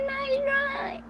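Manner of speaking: very high, thin put-on squeak; silly put-on voice. Brushing off an internet troll in a very high goofy voice. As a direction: squeak it out in a goofy cartoon pitch.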